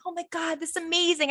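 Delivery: high-pitched, softly gushing retold excitement; retold awe. New camp staffer recalling her dream job, softly gushing. Retelling her thrilled younger self.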